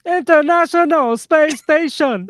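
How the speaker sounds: high, slow, laughing deadpan delivery; imitating Tan. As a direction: slowly imitate a friend's joke while giggling. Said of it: "And then Tan said".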